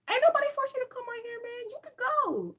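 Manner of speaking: high, loud, fast snap at a commenter; shrill-free loud clapback; exasperated outburst. Real, not acted. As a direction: shoo them off, fed up. Snapping at a rude commenter.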